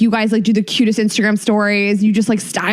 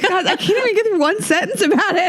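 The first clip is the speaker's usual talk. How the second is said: tearful laugh; 6 semitones higher than usual. Real, not acted. Co-host breaks down laughing.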